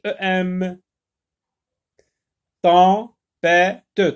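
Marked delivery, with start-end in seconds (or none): 2.6-4.2 "Tempête" split loudly as tem-pê-te; loud, measured beats on "Tempête"; three separate syllables. Shown as a demonstration. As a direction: sound out "Tempête" with a spoken final e. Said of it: "trois syllabes".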